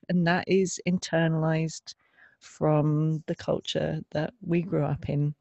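ordinary delivery

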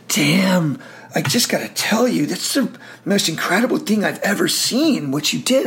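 lightly voiced officer, awed and loud; admiring praise. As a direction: gush over a soldier's feat.

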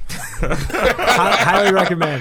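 laughing through the words. Real, not acted. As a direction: say it while cracking up. Laughing while recommending.

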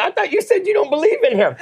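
very high, squeaky-pitched mock disbelief; incredulous retort. Believer to the shouting seatmate, high incredulous retort. Teasing retort in retold plane story.

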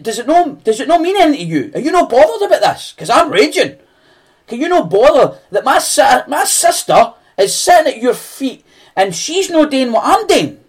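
high, loud, frantic complaint; angry Martha. Acted. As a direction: rant at Jesus, raging and high-pitched. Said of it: "She came up to him angry".

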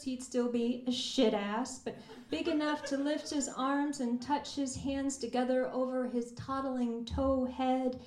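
usual speaking voice

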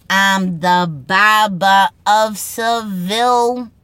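put-on a Boston barber joke in a Boston accent. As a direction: drag out the pun in a Boston accent.

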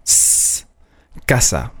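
a drawn-out voiceless S hiss, then casa with that s; sharp S. Shown as a demonstration. Teacher warning against soft S, casa with sharp S. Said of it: "wird immer scharf ausgesprochen".

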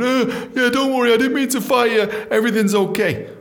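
high-pitched mock boss reassuring; imagined boss on voicemail. Boss leaves the hoped-for answerphone message, high mock reassurance. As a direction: put on a high, apologetic boss. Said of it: "praying there'd be some answer phone message".

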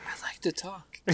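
playful whisper; breathy whispered joke. Pretend. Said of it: "Why are we whispering now?".

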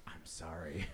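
hushed apology whispered in a dark cinema. Retold or imitated. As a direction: breathe it out so nobody else hears.

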